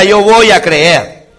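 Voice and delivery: slow, emphatic "Yo voy a creer"; call and response. Shown as a demonstration. Pastor urges the church to repeat after him. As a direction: drive the words home slowly.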